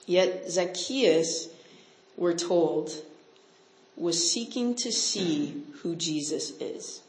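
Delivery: ordinary delivery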